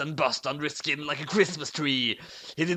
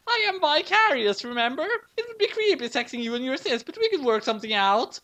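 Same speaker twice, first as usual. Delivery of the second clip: put-on much higher girlfriend voice; coy high girl; 10 semitones higher than usual. A narrator's reading. Girlfriend character speaks.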